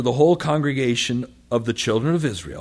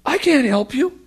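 much higher, helpless and exasperated; Moses; 14 semitones higher, 6 dB louder than usual. Acted. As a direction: throw up your hands, pitch way up. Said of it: "Moses says".

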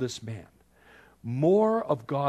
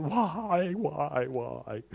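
mock whining; 3 semitones higher, much slower than usual. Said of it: "as long as we're saying".

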